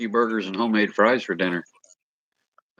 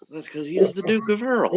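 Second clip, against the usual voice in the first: silly high voice; 13 semitones higher than usual. Pretend. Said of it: "I like this voice".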